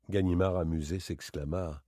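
ordinary delivery, nothing marked

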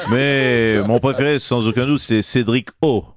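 low, put-on politician voice, hammed-up heartbreak; low mock-wounded lament; mock jilted politician. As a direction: play the betrayed boss in a low caricature voice. Same impersonated politician continues.

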